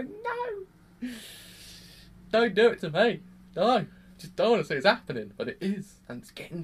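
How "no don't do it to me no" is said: high cry of no, then loud pleading; anguished cry. Retold or imitated. Fan begging the show to spare him, anguished cry. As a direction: wail at the screen in despair. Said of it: "which makes me sit here and go".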